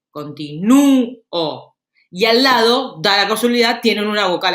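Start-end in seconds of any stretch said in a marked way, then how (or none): 0.1-1.8 CONTINÚO slowly, the Ú long and stressed, then a separate O; stressed closed vowel. Shown as a demonstration. Teacher showing where the accent falls, stretched Ú in CONTINÚO. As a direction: hold the Ú in CONTINÚO, then release the O. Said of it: "tienen la acentuación de la palabra".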